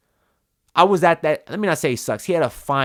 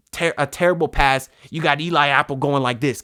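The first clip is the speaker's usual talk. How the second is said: ordinary talk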